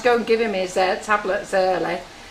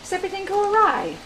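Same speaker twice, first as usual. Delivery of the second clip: high, concerned teacher voice; the teacher asks the mum, in a high concerned voice; mock concerned teacher; 11 semitones higher than usual. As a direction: ask it like a worried teacher. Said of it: "the teacher said".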